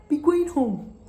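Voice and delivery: loud dismissal. Retold or imitated. Worker dismissing her.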